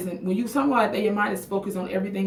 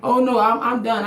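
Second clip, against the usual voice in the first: loud outburst; 4 semitones higher, 10 dB louder than usual. Real, not acted. Caught mid-chat, bursting out.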